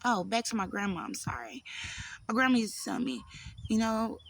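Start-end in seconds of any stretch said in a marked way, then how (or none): 1.0-1.6 quick soft whispered aside; real whispered sorry; whispered apology. Real, not acted. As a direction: breathe a sorry under your breath. Quick apology aside while losing track.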